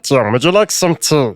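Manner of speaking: mimicked creepy Russian character; Russian Gollum. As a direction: creaturely voice with a Russian accent. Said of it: "almost like a Russian Gollum".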